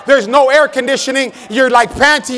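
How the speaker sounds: ordinary talk, nothing marked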